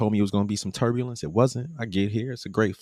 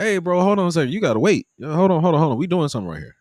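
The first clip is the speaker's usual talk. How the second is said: louder and higher, hold on repeated; raised hold-on-bro protest; firm interruption; 10 semitones higher, 6 dB louder, faster than usual. Retold or imitated. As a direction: cut him off firmly. Telling the man to wait.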